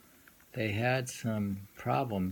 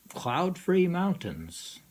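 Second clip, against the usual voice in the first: higher, slow, lifted title; announcing a poem title; 8 semitones higher, 5 dB louder, slower than usual. Acted. Lecturer announcing the poem's title, slow and raised. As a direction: announce the title slowly and grandly. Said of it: "I'll just read the poem".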